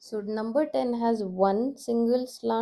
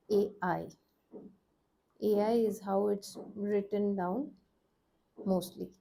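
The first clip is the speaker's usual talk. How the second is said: usual speaking voice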